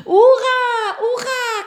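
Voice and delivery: high, loud laughing hurrah; joyful cheer. Acted. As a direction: cheer like you've just won. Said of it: "cria-t-il".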